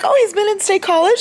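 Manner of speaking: high, loud mock-innocent question; giggly retelling. Reader retelling a funny quote, giggly imitation.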